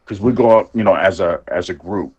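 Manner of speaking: ordinary talk, nothing marked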